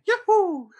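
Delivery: high, loud cartoon yahoo; Sonic-style whoop. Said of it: "just hearing".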